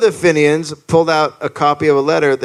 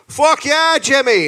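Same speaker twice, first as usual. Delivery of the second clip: high-pitched rowdy cheer; cheering rower; 10 semitones higher, slower than usual. Acted. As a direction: cheer your mate on mid-row. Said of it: "everyone's like".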